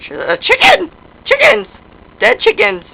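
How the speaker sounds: high, loud yelps; startled squeal. Pretend. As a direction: yelp it out high and sudden.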